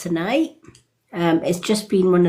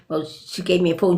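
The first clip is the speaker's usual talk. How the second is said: ordinary delivery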